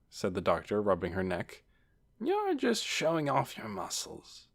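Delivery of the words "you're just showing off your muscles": high, soft reproof; chiding aunt. A narrator's reading. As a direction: quietly chide a show-off nephew. Aunt chides boastful nephew.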